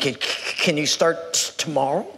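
put-on low, stammering old man; shaking elderly man. Old shop owner to job seeker, shaky stammer. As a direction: stutter haltingly on the first sounds. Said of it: "this man is kind of shaking".

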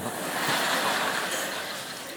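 a quick breathy laugh at his own joke; a soft chuckle to the congregation; short laugh. Real, not acted. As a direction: laugh it off lightly.

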